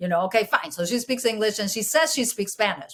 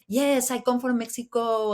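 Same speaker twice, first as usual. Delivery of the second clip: higher-pitched put-on Mexican accent; 5 semitones higher than usual. Shown as a demonstration. Newcomer telling Kansas classmates her origin, in a put-on Mexican accent. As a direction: lay on a little Mexican accent. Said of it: "little accent of".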